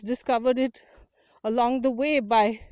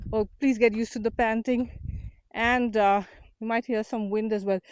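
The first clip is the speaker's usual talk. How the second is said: ordinary talk, nothing marked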